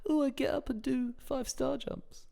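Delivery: high, soft, slow mock of a smug morning-routine person; mocking routine fan. Teasing co-host by voicing a smug morning-routine fan. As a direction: sneer it out like a smug fitness type. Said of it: "Do you actually have one of those".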